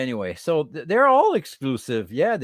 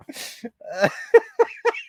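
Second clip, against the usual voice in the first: long high laughing fit; high, drawn-out wheezing laugh; helpless laughter; 9 semitones higher than usual. Real, not acted. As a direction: laugh until out of breath. Laughing at turkey axe.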